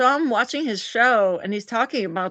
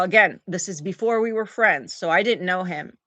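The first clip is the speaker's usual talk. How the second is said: usual speaking voice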